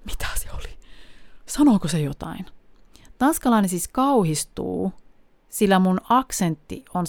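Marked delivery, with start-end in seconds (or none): 0.0-2.5 whispered, aghast muttering; shocked whisper. Retold or imitated. Shocked Dane whispering about the foreigner's speech. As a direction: hush it like a horrified stranger. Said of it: "järkytys on semmonen niinku".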